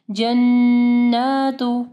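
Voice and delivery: जन्नातु with a long humming n and long aa; slow chanted जन्नातु, holding the double n; doubled noon, long vowel. Shown as a demonstration. Teacher models the word for students, drawn-out ghunnah. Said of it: "नून मुशद्दद है और खड़ी ज़बर है".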